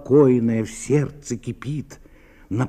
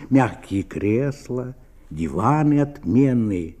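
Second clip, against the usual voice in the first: ordinary delivery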